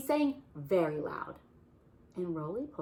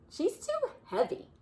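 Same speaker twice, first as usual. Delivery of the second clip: whiny Bear; 5 dB louder than usual. Acted. Bear complaining in story.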